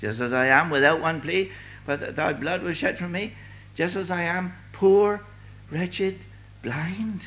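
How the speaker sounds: hymn recitation. Acted. Preacher quoting a hymn to challenge listeners, declaimed high. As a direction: build from line to line, holding the pitch up.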